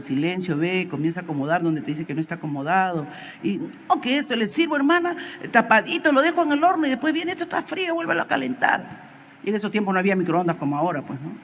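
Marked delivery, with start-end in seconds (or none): 3.9-8.8 high-pitched retold grumbling; exasperated complaint. Wife recounting her husband's cold-food fuss, exasperated complaint. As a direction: act out a fed-up wife, voice pitched up. Acting out a wife's complaint to pastor.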